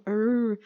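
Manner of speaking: long held R sound on "er"; syllable-final R. Shown as a demonstration. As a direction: sustain the R of "er" to demonstrate it. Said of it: "ele fica com esse som". ("er" com o R bem prolongado)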